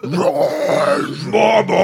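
high, loud rocker scream. Retold or imitated. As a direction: belt it like a wild rocker. Imitating David Arquette screaming.

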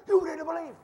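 high, loud shout of frustration; mock furious yell. Arguing believer yelling at a stubborn listener, hammed-up shout. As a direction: yell it like you've lost the argument. Preacher mocking a frustrated arguer.

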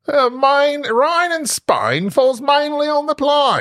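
slow, high, broad Cockney; Eliza Doolittle. Acted. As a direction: drag out the vowels in high mock Cockney. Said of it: "I always think of Eliza Doolittle".

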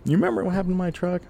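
a hint of a prying father; father probing his son years later, mildly prying; dad asking. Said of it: "he would ask me".

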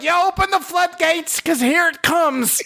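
high, gleeful announcement; fired up. Retold or imitated. About to unload on his wife, high and gleeful. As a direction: let loose with delight. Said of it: "I'm like".

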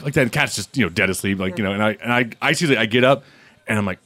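ordinary delivery

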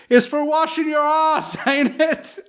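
high, laughing mock voice; mock husband voice. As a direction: tease it in a sudden high voice. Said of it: "he's like".

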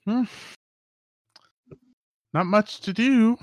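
put-on high-pitched old lady voice; high old villager. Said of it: "there's two just old ladies".

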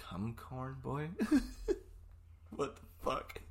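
slow, puzzled stoner; dazed and confused. Retold or imitated. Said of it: "I'm so high right now".

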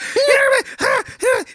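high-pitched squealing laugh; giddy high laugh. Retold or imitated. Bursting to tell his brother-in-law, squealing laugh.

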